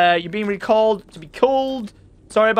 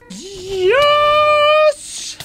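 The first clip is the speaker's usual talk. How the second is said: high squealed yes; excited cheer; 3 dB louder than usual. Real, not acted. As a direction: celebrate the win with a high cheer. Cheering after a kill.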